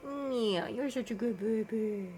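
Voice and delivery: cooing pet praise. Real, not acted. Owner praising her cat in cooing baby talk.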